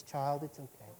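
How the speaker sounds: soft, hushed fatherly reassurance; tender comfort. Acted. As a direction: soothe a hurting child. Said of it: "he says".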